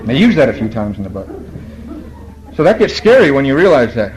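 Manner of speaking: ordinary talk, nothing marked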